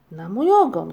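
loud startled cry; shouted exclamation. A narrator's reading. Moomin papa shouts at a plum stain, loud cry. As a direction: burst out loudly in surprise. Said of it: "krzyknął".

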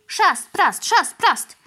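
high, loud rhythmic chant; flapping crow chant; wing flaps. A narrator's reading. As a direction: mimic wings beating as he flies.

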